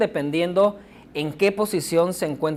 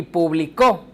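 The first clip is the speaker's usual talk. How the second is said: publicó ending on a punched CÓ; stress on last syllable; slower than usual. Shown as a demonstration. Teacher contrasting stress patterns, stressing the end of publicó. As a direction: land the weight on the final ó of publicó. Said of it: "en la tercera está al final".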